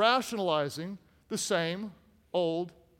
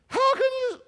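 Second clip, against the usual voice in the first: very high, cracking falsetto; failed woman voice; 5 dB louder than usual. Acted. Preacher reading Delilah's line, bad woman voice. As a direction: squeak out a badly faked woman. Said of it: "my woman voice isn't working very good".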